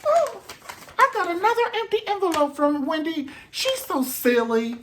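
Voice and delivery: mock puzzled recipient. Comic voicing a rival opening Wendy's envelope, high mock puzzlement. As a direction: squeak it out, baffled.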